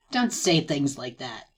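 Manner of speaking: very low, slow, soft protest; deepest voice. Acted. Club girl objects to praise, deep and slow. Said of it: "Put on your deepest voice for this".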